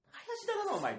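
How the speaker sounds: high mocking call-out; teasing senior. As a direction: tease him from above. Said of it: "っていう".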